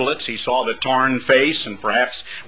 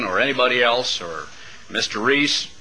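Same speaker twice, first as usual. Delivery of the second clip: ordinary delivery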